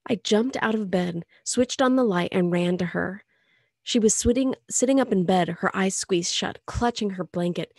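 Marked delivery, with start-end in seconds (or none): none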